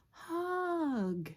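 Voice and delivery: high, drawn-out call of hug; sing-out cry falling at the end. A narrator's reading. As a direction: call it out long and high for the little listeners. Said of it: "He's calling out".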